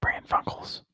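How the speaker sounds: hushed show title; breathy whispered title; whispering. Pretend. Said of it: "We're just whispering the whole show now".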